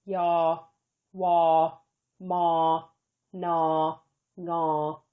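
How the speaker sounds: yaw, waw, maw, gnaw, ngaw spoken slowly one by one as Thai final consonant sounds. Shown as a demonstration. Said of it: "one of the following consonant sounds".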